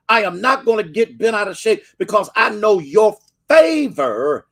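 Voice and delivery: high, rising preacher's cadence; impassioned sermon build; fervent preaching. Acted. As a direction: preach it with full fire, climbing. Preaching to viewers at full force.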